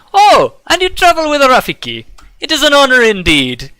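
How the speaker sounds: merchant voice pitched well up, delighted; excited merchant. Acted. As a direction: gush with respect.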